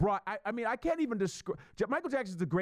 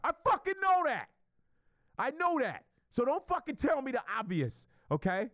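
exasperated outburst; 12 semitones higher than usual. Real, not acted. Fed-up retort to critics.

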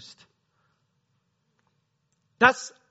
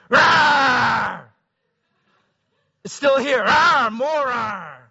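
higher, louder hammed-up angry roar; 6 semitones higher, 7 dB louder, much slower than usual. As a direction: roar at the problem like a frustrated beast. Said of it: "was frustration and anger".

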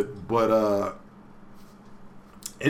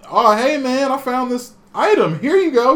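a lightly higher, cheery helper voice; friendly side character; 12 semitones higher, 5 dB louder than usual. Retold or imitated. A game character offering Haseo an item, lightly higher and cheery. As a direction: hand over the item, sweetly mock-nice. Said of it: "characters are literally like".

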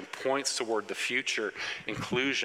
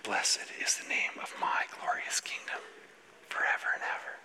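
hushed whisper of a prayer, all breath; Jesus whispering; 4 dB quieter than usual. Retold or imitated. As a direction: whisper it under your breath, unhurried. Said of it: "I can just hear Jesus whispering it".

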